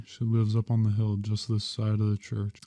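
ordinary delivery

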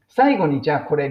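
ordinary delivery

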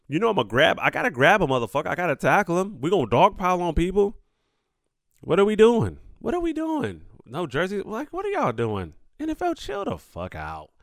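incredulous rant. Real, not acted. Vlogger mocks the NFL jersey swap ban, incredulous and rising. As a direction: pile on the disbelief, voice climbing.

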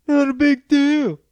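a squeaky put-on retort; high mock voice. Shown as a demonstration. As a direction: pitch it way up like a taunt. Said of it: "repeats it in another voice".

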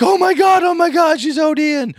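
very high, loud cry of alarm; acted high-pitched panicked yelling; panicked onlookers. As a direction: shriek like a bystander in a mock panic. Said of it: "And people would start to panic".

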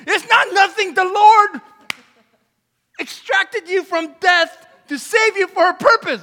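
loud, high, emphatic rebuttal; impassioned preaching. Real, not acted. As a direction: burst out in full preacher's force. Preaching at full force.

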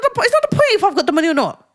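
high, fast exasperated retort. Retold or imitated. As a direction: retell an indignant protest, pitched high. Retelling her protest to her friend.

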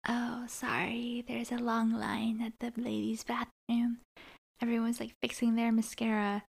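soft, whispery retold apology; hushed imitation. Host imitating Quinn getting into the car, hushed and offhand. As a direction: drop to a near-whisper for the excuse.

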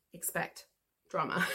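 ordinary delivery

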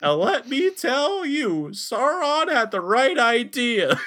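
loud, high, very drawn-out mock-drunk voice; mocking drunk imitation. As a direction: exaggerate a friend's slurry bragging, stretching every word. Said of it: "you said it like".

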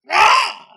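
sudden high laugh; high burst of laughter. Real, not acted. GM laughs at familiar.

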